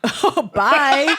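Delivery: giggling through a farewell; laughing quote. Retold or imitated. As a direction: laugh it out while saying bye. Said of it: "him going".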